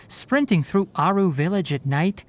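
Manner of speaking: ordinary delivery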